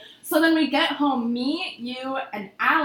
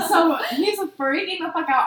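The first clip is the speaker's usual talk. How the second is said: ordinary talk, nothing marked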